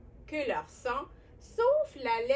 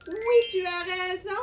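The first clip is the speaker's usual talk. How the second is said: excited praise; 5 semitones higher, 7 dB louder than usual. Pretend. Praising a child's answer.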